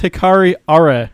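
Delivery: "Are" said in two syllables, like "ah-reh"; corrected pronunciation. Shown as a demonstration. Host corrects Danny on a song title, "Are" pronounced "ah-reh". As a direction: say "Are" with a final "eh". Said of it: "it's pronounced".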